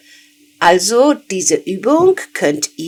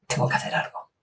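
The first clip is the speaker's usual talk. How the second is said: whisper; 16 dB quieter, much faster than usual. Real, not acted. Aside after Tengo hambre.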